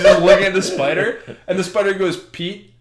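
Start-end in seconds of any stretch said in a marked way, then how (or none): 2.2-2.7 soft, low, mock-solemn pronouncement; grave punchline. Spider breaking mortality to Pete, mock-grave and hushed. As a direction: let it fall quietly, with a pause after the name.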